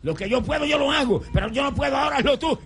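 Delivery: crying out to God. Shown as a demonstration. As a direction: shout it up to God, begging. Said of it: "sáquese el grito".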